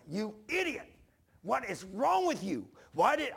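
furious with himself after the crash; yelling at himself. Retold or imitated. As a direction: berate yourself at the wreck. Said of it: "I'm talking to myself".

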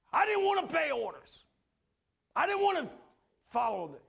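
high, loud outburst easing at the end; rebellious yelling. Acted. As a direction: act out shouted defiance of orders. Acting out his rebellion after call.

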